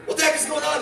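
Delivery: mock outraged shout. Man in the stall to guard, high indignant yell.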